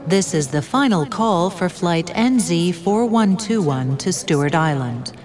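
airport announcement. Acted. Airport final call announcement.

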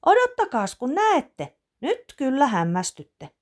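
put-on high, loud child calling out; girl calls her family to see her surprise, high excited shout. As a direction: shout it to the whole house. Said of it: "ja huutaa".

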